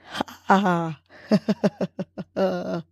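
soft ha ha fading into a real laugh; laughing on the exhale. Shown as a demonstration. Host laughing with listeners on the exhale, soft laugh. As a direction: laugh the breath out gently. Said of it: "let it out with the laughter".

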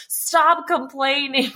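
drawn-out, high-pitched mock yell. Mary scolds the dreamer after surgery, mock yelling. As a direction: imitate an exasperated yell, stretching each word. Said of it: "yelling at me saying".

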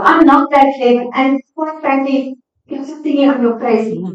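ordinary talk, nothing marked

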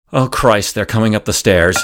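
ordinary talk, nothing marked

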